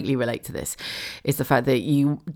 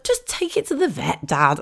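exasperated. Retold or imitated. Exasperated retort to dad.

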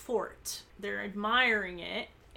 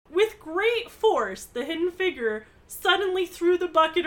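dramatic emphasis; 7 semitones higher, 6 dB louder than usual. A narrator's reading. Dramatic reading of action.

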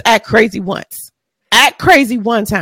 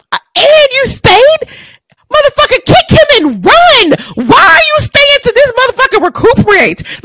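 much higher, loud, half-crying yell; outraged yell; 13 semitones higher, 4 dB louder than usual. Real, not acted. Reacting to the woman who stayed in the fight, outraged cry-yell. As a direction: yell at her in disbelief, pitch way up.

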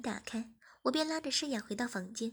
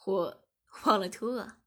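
lower, slow, loud playful excuse; slow mock-innocent reply; 4 semitones lower, 5 dB louder than usual. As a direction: play dumb on purpose. Boyfriend teasing, fake innocence. (故作无辜地慢慢说)

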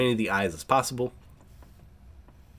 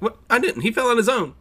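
much higher, louder squeaky protest; accused boy protests innocence in a high, loud squeak; mock innocent denial; 12 semitones higher, 6 dB louder than usual. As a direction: play the wide-eyed innocent kid, high and loud. Boy denies flicking the other.

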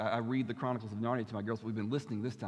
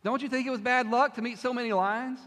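put-on high, loud frightened boy; lightly voiced anxious boy; young boy voice; 11 semitones higher, 6 dB louder than usual. Boy character quoted in reading.

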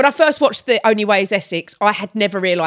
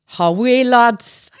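slow, drawn-out Geordie greeting; Geordie accent; much slower than usual. Shown as a demonstration. Demonstrating accent number two, slow Geordie accent. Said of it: "number two was Geordie".

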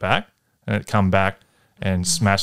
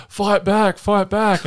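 retold scream; 12 semitones higher, much slower than usual. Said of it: "she's like".

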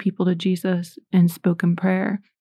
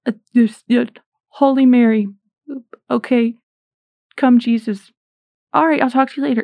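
mock fumbling first prayer; 4 semitones higher, 3 dB louder than usual. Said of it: "like a, a speech impediment".

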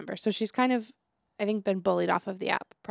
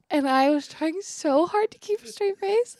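laughing; 9 semitones higher, 3 dB louder than usual. Real, not acted.

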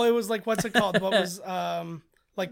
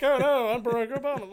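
mock teenager; 6 semitones higher, 5 dB louder than usual. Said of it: "a teenager who's like".

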